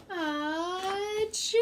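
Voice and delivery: big bear sneeze; loud sneeze. Acted. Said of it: "makes the bear".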